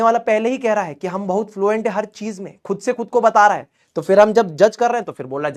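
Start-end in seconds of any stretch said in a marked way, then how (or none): none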